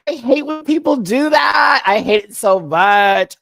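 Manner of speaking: loud, high annoyed rant; loud complaint. Real, not acted. Complaining about people not saying hi, loud. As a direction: vent it loud. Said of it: "vents annoyance".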